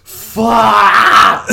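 real outraged laughing yell; laughing shout. Real, not acted. Reacting to the co-host's hated pick, a laughing shout. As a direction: blurt it out loud, half laughing.

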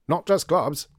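put-on loud voice of a deaf old colonel; loud deaf-man voice. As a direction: speak up like he can't hear himself. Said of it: "the loud voice of the hard of hearing".

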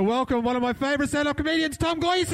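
high, big game-show announcer. Acted. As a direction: introduce the guests like a showman. Announcing guests show style.

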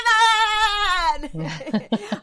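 loud, high sing-song laugh; sung cackle. Acted. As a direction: cackle gleefully on a rising note. Laugh after intro slogan.

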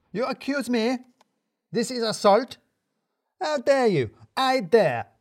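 defiant outrage at the boss; furious Radu. A narrator's reading.

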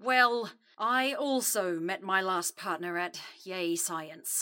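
low, slow, subdued confession; reluctant admission. Acted. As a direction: admit it grudgingly, trailing off. Grudging admission about last partner.

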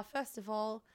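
ordinary delivery

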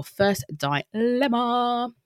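drawn-out, high held last note; sing-song announcement. Pretend. Podcast host introducing the first dilemma, sing-song announcement. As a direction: announce it like a jingle, stretched out.

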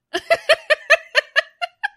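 loud, high laughter. Real, not acted. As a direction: burst out laughing. Laughing at the cheer.

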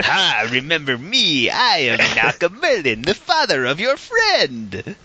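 high, slow hammy villain speech; movie villain voice. Retold or imitated. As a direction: ham it up like a cartoon villain. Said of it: "That's a great impersonation".

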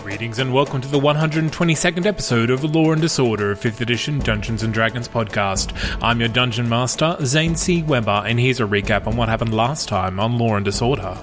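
ordinary talk

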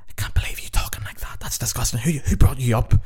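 shocked nan telling off her grandson; grandmother scolding. Retold or imitated. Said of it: "she's like".

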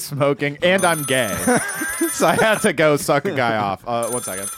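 normal talking voice

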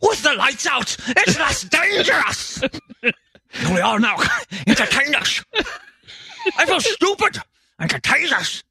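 high, mumbled grunge singer voice in a Scottish lilt. Pretend. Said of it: "he's the Scottish [name]".

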